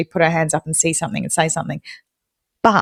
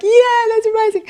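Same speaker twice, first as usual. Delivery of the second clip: high-pitched mock-thrilled squeal; mock excited; much faster than usual. Co-host thrilled with only runner-up, high mock excitement. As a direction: gush it high, sending up your own delight. Retold joy at being runner-up.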